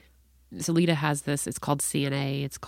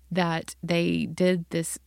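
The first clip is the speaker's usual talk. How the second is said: ordinary talk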